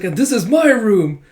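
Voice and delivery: acted cheerful tour guide; proud showing-off. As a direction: present it like a proud child. Child proudly shows off her room.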